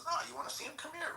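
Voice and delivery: mock high woman's voice calling out. Retelling the woman at the car, mock high-pitched woman's voice. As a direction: pitch way up like the woman inviting him over. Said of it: "He goes".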